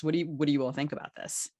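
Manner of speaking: normal talking voice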